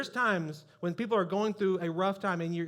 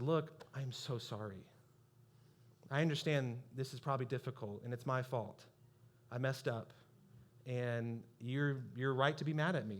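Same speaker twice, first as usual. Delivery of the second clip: sincere apology; 6 semitones lower, 7 dB quieter than usual. Retold or imitated. Pastor apologising to the bride by voicemail, soft and humble. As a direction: own it quietly and gently. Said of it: "I ate the biggest portion of crow".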